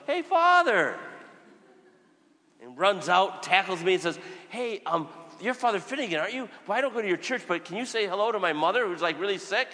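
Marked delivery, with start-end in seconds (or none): 0.1-1.0 high, loud call across the ward; yelling. Retold or imitated. Stranger yelling to the priest down the hospital corridor. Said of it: "someone yells". 4.5-9.7 higher, pleading request; eager stranger. Retold or imitated. A hint of a worried son asking the priest to visit his mother.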